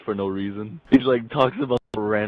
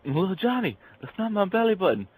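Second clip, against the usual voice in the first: mock scream; 10 semitones higher than usual. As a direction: pretend to cry out in alarm, pitch jumping up. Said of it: "Screams for a sec".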